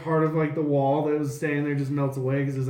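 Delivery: ordinary talk, nothing marked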